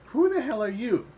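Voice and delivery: high-pitched, affronted demand; indignant challenge. Acted. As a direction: confront an intruder, voice jumping up. Startled man confronts intruder.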